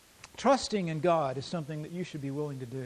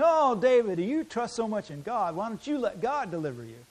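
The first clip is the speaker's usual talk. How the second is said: sneering, sing-song taunt, higher and louder; mocking taunt; 6 semitones higher, 5 dB louder than usual. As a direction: jeer at him like a scornful mocker. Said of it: "they're mocking him and they're making fun of him".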